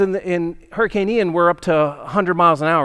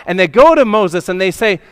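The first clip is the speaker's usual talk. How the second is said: usual speaking voice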